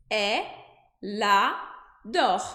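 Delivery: elle adore broken into e, la, dor; split syllables. Shown as a demonstration. Teacher slows elle adore into syllables. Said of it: "eu vou dizer".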